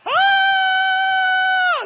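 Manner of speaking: very high, loud falling scream. Retold or imitated. Speaker reliving the drop, screaming loud and high. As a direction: let it rip like dropping off a cliff.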